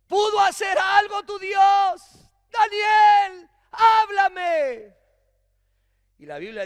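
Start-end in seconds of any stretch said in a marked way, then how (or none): 0.1-4.9 loud, high desperate shouting; frantic king shouting into the lions' den; anguished shout. Acted. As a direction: beg for an answer at full voice. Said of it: "gritó con angustia".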